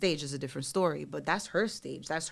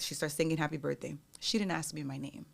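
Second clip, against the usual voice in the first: plain reading voice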